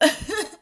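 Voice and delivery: a quick high embarrassed giggle. Real, not acted. Vlogger laughing at her own makeup skills, high giggle. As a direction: laugh off the worry in a short high burst. Embarrassed about not being professional.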